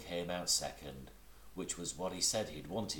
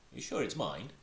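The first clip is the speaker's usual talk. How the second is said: squeaked excitedly; 6 semitones higher than usual. Acted. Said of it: "squeaked Piglet excitedly".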